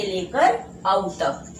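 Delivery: slow, syllable by syllable, like dictation; slow sounding out. Shown as a demonstration. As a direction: spell out ऐसे लेकर औ तक very slowly for the class. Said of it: "teacher sounds out the vowel range". (धीरे-धीरे, हर शब्द अलग करके)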